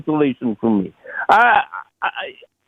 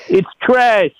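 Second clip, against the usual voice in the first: high-pitched impression of a tough-talking actor; James Gandolfini; 7 semitones higher, slower than usual. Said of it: "James Gandolfini say".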